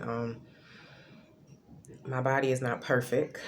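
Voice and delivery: usual speaking voice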